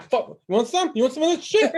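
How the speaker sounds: high, loud mock tough guy squaring up to its tail; the dog, voiced as a cocky brawler, taunting her own tail; tough-dog challenge. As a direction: pick a fight like a cocky little dog. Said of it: "she was like".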